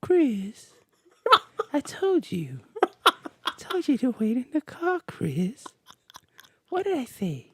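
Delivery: very high falsetto, slow and whiny near the end. Retold or imitated. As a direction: whimper up high as if about to cry. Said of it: "How do you do that?".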